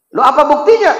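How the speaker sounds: high, loud demand for proof; king challenging the boy; demanding challenge. Acted. King demands proof from Ibrahim, loud challenge.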